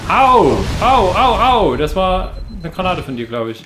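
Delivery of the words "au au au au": sharp high yelps of pain. Real, not acted. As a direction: cry out as the grenade hits. Hit by own teammate's grenade.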